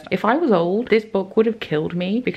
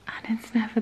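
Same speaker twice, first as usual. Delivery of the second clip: anxious whisper; 5 semitones higher, 5 dB quieter than usual. Real, not acted. Worrying about twist.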